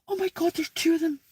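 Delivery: high, quick astonished remark; real surprise. Real, not acted. Woman spots two rabbits, high quick surprise.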